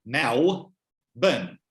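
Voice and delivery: MELBOURNE split slowly in two, "Mal ... bn"; Melburnian, dark L and swallowed second vowel; Melburnian accent. Shown as a demonstration. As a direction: spell out the Melburnian sound syllable by syllable. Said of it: "is pronounced with the Melburnian accent".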